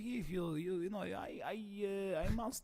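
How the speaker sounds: soft, halting Russian accent; put-on Russian accent, offering vodka. Shown as a demonstration. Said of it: "I can do Russian".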